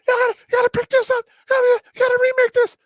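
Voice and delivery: high, fast, panicky mock officer; freaking-out lieutenant. Lieutenant panicking at him to remake it, high fast mock voice. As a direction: squeak it out like a flustered lieutenant. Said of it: "He's freaking out".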